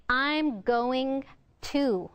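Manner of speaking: "going to" slow, as two separate words; very slow, fully sounded "I'm going to"; clear enunciation. Shown as a demonstration. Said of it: "you want to say".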